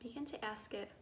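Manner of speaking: soft, unhurried meditation voice with long pauses; guided meditation. Acted. Guide inviting listeners to get curious about body sensations, soft meditative calm.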